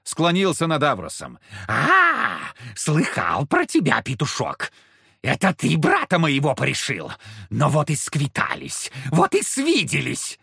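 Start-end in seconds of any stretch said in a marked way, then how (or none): 1.7-10.4 a tipsy demon gloating; drunk voice. Acted. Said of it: "пьяным голосом".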